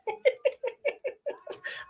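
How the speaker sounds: high breathless giggle; airy giggle, no voice; breathy giggle. Real, not acted. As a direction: laugh at your own silliness. Laughing at herself.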